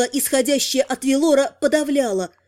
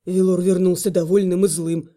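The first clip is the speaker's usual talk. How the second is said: alarmed; 7 semitones lower than usual. Acted. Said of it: "всполошился Слава".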